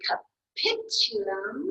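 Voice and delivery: capitulum drawn out slowly, high on the second syllable, pit; slow stressed demo of capitulum. Shown as a demonstration. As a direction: teach capitulum, pushing up on pit. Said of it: "you put the emphasis on that middle or that second syllable".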